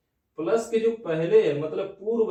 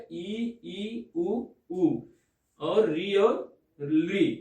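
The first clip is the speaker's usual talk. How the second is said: dictating slowly; much slower than usual. Shown as a demonstration. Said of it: "teacher listing vowels slowly".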